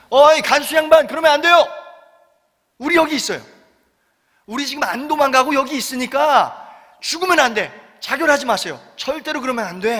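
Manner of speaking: shouted plea from across the cell; calling out. Retold or imitated. Paul and Silas shouting to the jailer, urgent call. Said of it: "갑자기 누군가 소리칩니다".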